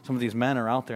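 ordinary delivery

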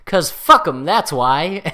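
loud, punchy comic delivery of the line; loud Dave Chappelle punchline voice; Dave Chappelle impression. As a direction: bark it like a sketch punchline. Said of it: "it's Dave Chappelle going".

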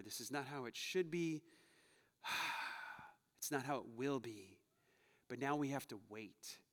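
mournful lament. Retold or imitated. Prophets mourning over the people, soft and sorrowful. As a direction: grieve quietly over the mess.

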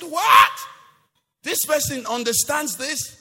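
high loud astonished \"What?\"; Jesus astonished at Stephen, high and loud; amazed shout. Retold or imitated. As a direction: burst out in disbelief.

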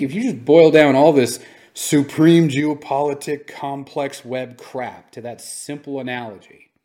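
normal talking voice

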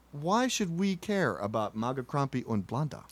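ordinary talk, nothing marked

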